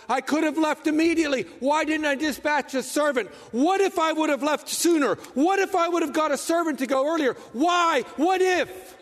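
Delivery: high, loud, racing regrets; a grieving father berates himself, frantic and high; frantic self-blame. Retold or imitated. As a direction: spiral into panic over each question. Father's guilty thoughts after the news.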